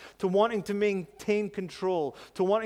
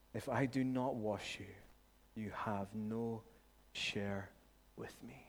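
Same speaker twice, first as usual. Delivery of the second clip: gentle Jesus; 7 semitones lower, 12 dB quieter than usual. Retold or imitated. Jesus answering Peter, voiced.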